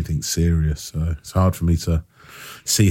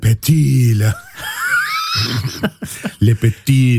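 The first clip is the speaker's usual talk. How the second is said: mock French accent, laughing; hammed-up French accent; 6 semitones higher than usual. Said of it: "that's my French accent".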